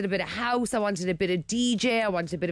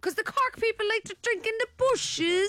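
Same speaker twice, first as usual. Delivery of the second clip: high Cork lilt; Cork accent; 13 semitones higher than usual. Pretend. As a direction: keep the Cork voice. Continues Cork voice.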